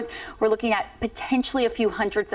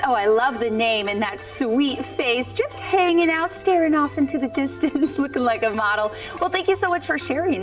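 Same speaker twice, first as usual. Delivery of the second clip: cooing; 3 semitones higher than usual. Real, not acted. Cooing over viewer's baby photo.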